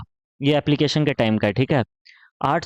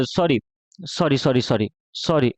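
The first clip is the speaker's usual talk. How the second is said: mock apology; 3 semitones lower than usual. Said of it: "मैं थोड़ा सा भावनाओं में बह गया".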